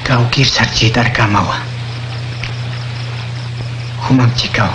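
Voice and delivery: ordinary talk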